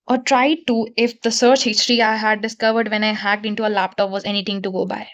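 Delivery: low, level book-reading voice; reading aloud. Acted. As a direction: read it out in a low monotone. Reads the novel aloud.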